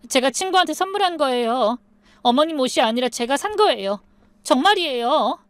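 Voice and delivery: high-pitched, insistent self-defence; pleading protest. A narrator's reading. Accused daughter-in-law insists to mother-in-law, pleading.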